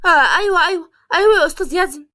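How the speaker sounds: high, loud, flustered jolt; startled. A narrator's reading. Employee caught distracted by her boss, startled. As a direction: snap to attention, startled, words tumbling out fast. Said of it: "هو فرح بخضة".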